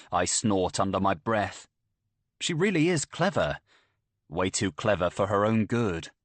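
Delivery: ordinary delivery, nothing marked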